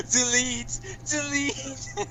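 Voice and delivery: imitating a high chanted scream; Matt Hardy screaming. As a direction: mock-scream the word like a crazed robot. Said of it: "how Matt Hardy was just screaming".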